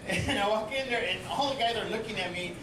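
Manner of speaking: ordinary talk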